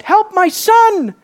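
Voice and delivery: high, drawn-out mock plea; crying out. Preacher voicing a father's plea for his son, crying out high. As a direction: cry out like a desperate father. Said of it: "He didn't cry out".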